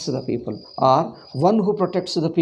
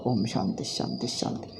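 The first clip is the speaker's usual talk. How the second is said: soft, low closing chant; closing prayer; 6 semitones lower, 5 dB quieter than usual. Real, not acted. Teacher closes the class with Om Shanti, soft prayer chant. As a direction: intone a quiet Sanskrit blessing, drawing out the last Om.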